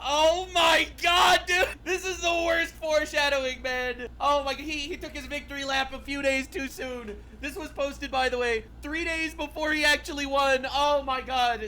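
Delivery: high, loud and slow disbelief; excited shout. Real, not acted.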